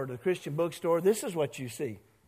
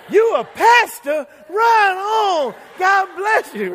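excited shout; 13 semitones higher, 13 dB louder, slower than usual. Retold or imitated. Painter shouts on hearing news.

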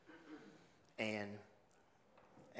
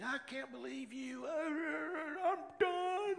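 much higher, sing-song huffy outburst; melodramatic wife; much slower than usual. Pretend. Pastor mocking how a wife could have reacted, melodramatic huff. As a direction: overplay an offended spouse storming off. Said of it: "She didn't dramatize it".

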